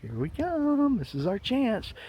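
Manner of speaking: scheming whales eyeing their chance; gloating big players. Retold or imitated. Big buyers gloating over the dip, sly and soft. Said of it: "they're going".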